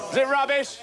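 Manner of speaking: high, loud appeal to the crowd; rousing shout. Acted. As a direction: yell the question out to an audience. Whipping up the audience.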